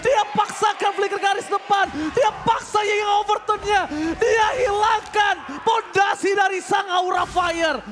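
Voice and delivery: loud, high play-by-play yell; hype shout. Real, not acted. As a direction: scream the big play to the crowd. Caster erupts at a big play. (teriakan komentator yang heboh)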